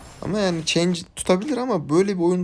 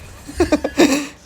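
short, high burst of laughter; real amused cackle at the play; laughing; 13 semitones higher, 6 dB louder than usual. Real, not acted. Gamer laughs at the play, amused laugh.

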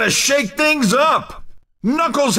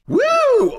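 high-pitched celebratory "woo"; excited whoop; 13 semitones higher than usual. Acted. Announcer cheering player four's All-Star, high excited whoop. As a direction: whoop it up for the crowd.

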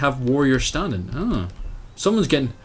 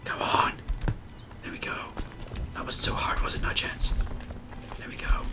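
whisper; 11 dB quieter than usual. Real, not acted. Whispering to units while raiding.

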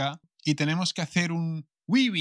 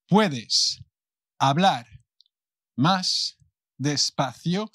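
one word at a time, with pauses; palabra por palabra; much slower than usual. Shown as a demonstration. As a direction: separate every word for a learner. Models the request word by word. (palabra por palabra, con pausas)

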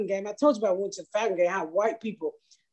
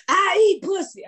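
high, raised brazen holler; heckler's shout; 10 semitones higher, 5 dB louder than usual. Retold or imitated. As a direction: mimic a heckler calling out proudly. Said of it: "this bitch will heckle me".

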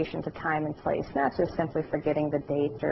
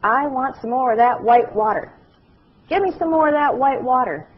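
insistent demand; 6 semitones higher, 6 dB louder than usual. Retold or imitated. Man asks caregivers for milk, loud and insistent. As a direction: demand it like a stubborn patient. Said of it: "he said".

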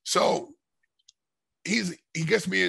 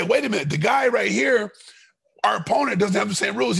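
raised voice, pitched up and loud; retold angry shout; 7 semitones higher, 6 dB louder than usual. Fighter protesting to the official about the rules, shouting.

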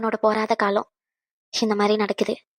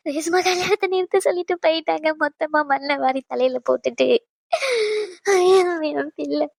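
wailing; 6 semitones higher than usual. Acted. Mother lamenting the cancelled wedding.

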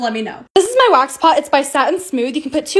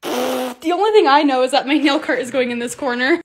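usual speaking voice

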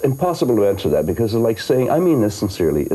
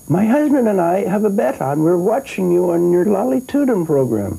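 lightly voiced old lady, higher; little old lady voice; 12 semitones higher than usual. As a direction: play the chatty old lady from the street, higher. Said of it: "the little old lady looked up".

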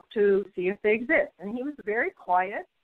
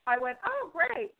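mock surprise; 8 semitones higher, slower than usual. Feigned delight at doctor's news.

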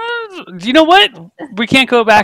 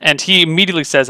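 ordinary delivery

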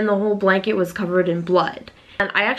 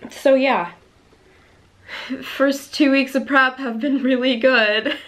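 higher, joking with a laugh before turning serious; sarcastic laughing; 6 semitones higher than usual. Acted. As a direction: laugh off the bad news sarcastically. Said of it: "no, for real though".

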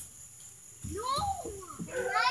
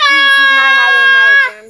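high shouting protest; crying shout; 8 semitones higher, 10 dB louder than usual. Real, not acted. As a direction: shout it out, near tears. Fighting brother over candy.

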